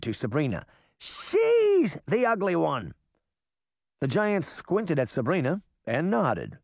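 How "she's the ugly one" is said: high, slow emphatic call; high drawn-out boyish shout. A narrator's reading. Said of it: "Puck shouted, then pointed".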